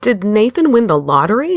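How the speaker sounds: high startled what; shocked squeak of surprise; surprised gasp. Retold or imitated. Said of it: "my email and just went".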